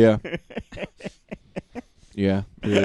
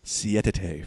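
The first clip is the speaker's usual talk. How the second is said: Spanish; 5 semitones lower, 6 dB quieter than usual. Shown as a demonstration. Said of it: "for our Spanish listeners".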